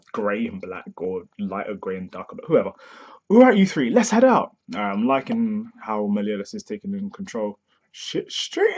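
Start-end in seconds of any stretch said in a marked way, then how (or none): 3.3-4.6 higher, louder leader's order; rally the team like a captain; commanding call. Acted. Meliodas leads his comrades out with a loud, higher order.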